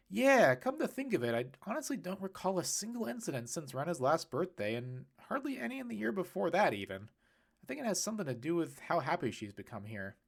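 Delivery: a hint of a cheerful man realizing something; Kyle, musing. A narrator's reading. Kyle musing about Rena.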